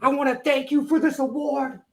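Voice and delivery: thick New York accent, hammed up; Eddie Kingston New York accent. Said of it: "do that horrible New York accent".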